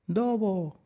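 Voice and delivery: put-on high little baby-talk call; lamb calling out. A narrator's reading. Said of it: "gritó". (llamada aguda de ovejita)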